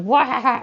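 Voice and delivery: loud, high goofy "wah"; silly wail. Retold or imitated. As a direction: blurt out a silly mock cry. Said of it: "do dumb things and just be like".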